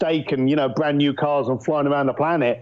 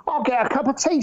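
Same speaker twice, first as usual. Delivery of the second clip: a hint of mum, higher pitched; mum's voice; 9 semitones higher than usual. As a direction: lightly voice mum offering tea. Mum voiced offering tea.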